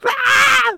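loud, high-pitched shriek; mimicked wife's scream. Wife afraid he'll get beat up, mimicked shrill scream. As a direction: let out an imitation panicked scream. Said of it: "screaming at me".

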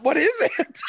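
high, laughing question; cracking up while asking. Acted. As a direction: ask it through rising laughter. Imagined worried reaction, laughing.

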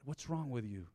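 ordinary talk, nothing marked

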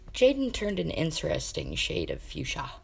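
ordinary delivery

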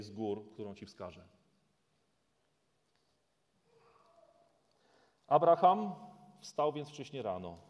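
solemn dramatic Bible reading; scripture reading. Acted. Preacher reading Genesis to the congregation, solemn. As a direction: read the story with weight. Continues reading the sacrifice story.

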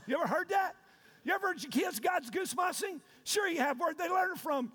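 ordinary talk

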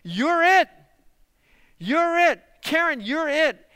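high, loud, pointed declaration; emphatic. Real, not acted. Preacher telling his congregation they are it, emphatic and loud. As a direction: drive home that each one of them is it. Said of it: "it's emphatic".

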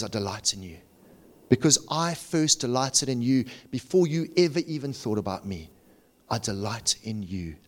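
hushed, tender divine promise; tender reassurance. Acted. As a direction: drop down low and quiet to reassure. Voices God reassuring the believer.

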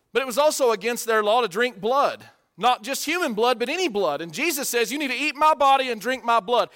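loud, high, emphatic preaching; full-force preaching. Real, not acted. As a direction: drive it home from the pulpit.

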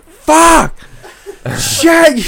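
loud, high retold screaming of curse words; reliving the furious shout on the walk back. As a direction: yell each curse out in frustration. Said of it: "screaming curse words on the walk back".

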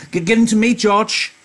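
high, loud stammering plea; pleading Lenny. Acted. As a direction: beg for the puppy back like a child.

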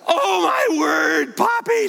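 very high, drawn-out thrilled shriek; imitated child's screaming. Grandfather imitating granddaughter screaming to Poppy on the ride, thrilled shriek. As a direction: shriek it like a thrilled little girl. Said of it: "She's screaming".

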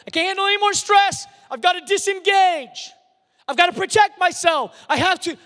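high, fast mock-overwhelmed plea; stressed-out person. Preacher voicing people who want to avoid stress, mock-overwhelmed. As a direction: cry out like someone at the end of their tether. Acting out someone fleeing stress.

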